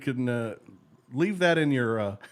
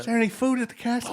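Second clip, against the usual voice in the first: quick, higher British peasant voice; hammed-up commoner, pitched up; royal subject; 5 semitones higher, 4 dB quieter, faster than usual. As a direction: play a hungry subject asking the king. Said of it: "and you're a subject".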